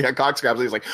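usual speaking voice

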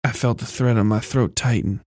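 plain reading voice

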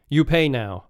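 hammed-up stern monk voice; blunt monk demand. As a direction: imitate the head monk cutting straight to the bill. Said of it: "turns to Canadian Steve and says".

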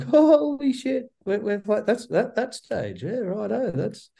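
put-on high, slow, childlike wonder; a small boy gaping up at a big stage; little kid [name]. [name] as a wide-eyed kid seeing the stage, high and slow. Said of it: "little kid [name], like".

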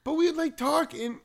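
put-on high childish voice; little kid voice. Shown as a demonstration. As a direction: talk like a small child. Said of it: "a little kid voice".

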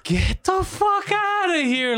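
high, slow mock-dramatic line read; reading the bad line. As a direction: read it out like a stiff movie actor. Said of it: "so I read that, I go".

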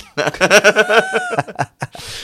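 high, loud burst of laughter. Real, not acted. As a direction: crack up loudly at your own answer. Cracks up at own prediction.

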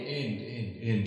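echo voice. Pretend. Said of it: "add echo to your echo".

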